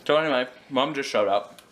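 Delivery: talking while chewing; words around a bite of apple; mouth full. Real, not acted. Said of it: "One second, I'm chewing".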